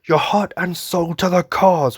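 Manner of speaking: high, slow, declaimed commander call; heroic salute cry. Retold or imitated. Imitating Irvin calling his soldiers to the cause. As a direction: proclaim it like a battle oath. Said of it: "the first time says".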